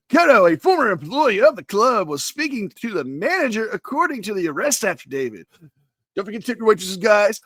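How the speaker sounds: overhyped club DJ patter. Acted. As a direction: swoop up and down like a hyped-up DJ. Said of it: "as I slip into my D J voice".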